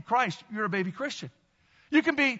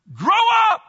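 big high call of grow up; called out loud; 10 semitones higher, 10 dB louder than usual. Shown as a demonstration. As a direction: lead the church in the words. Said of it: "say it with me".

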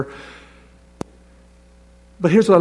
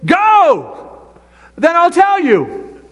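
high, slow, commanding "Go"; voice of God; 11 semitones higher, 3 dB louder, much slower than usual. Retold or imitated. Preacher voicing God's reply to believers, high and firm. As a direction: answer as God would, firmly. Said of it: "God says".

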